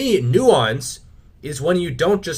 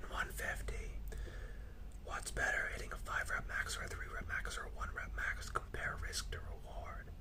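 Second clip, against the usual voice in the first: hushed, voiceless whisper; 20 dB quieter than usual. Real, not acted. Host reading out a viewer's fitness question, whispered. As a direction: read it out in a very soft whisper.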